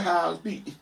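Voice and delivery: "speak" high and slurred, Atlanta mumble-rap accent; imitated mumble accent. Shown as a demonstration. Mocking rappers who copy Atlanta talk, high mumbled accent. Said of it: "I'm not gonna be like".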